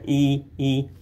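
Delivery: "ई" with the mouth opened wide; Norwegian i vowel. Shown as a demonstration. Teacher shows how to shape the i vowel, carefully. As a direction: model "ई, ई" with an open mouth. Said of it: "इसमें हमने मुंह को खोलना".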